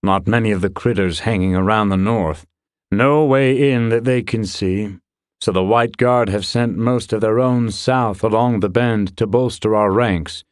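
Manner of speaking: lightly voiced soldier reporting in; brisk opening, then steady military report; soldier's report. Soldier briefing a commander on the defences, lightly voiced report. Character reporting before battle.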